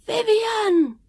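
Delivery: high screamed call of a name; high cry after someone running away. A narrator's reading. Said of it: "someone screamed".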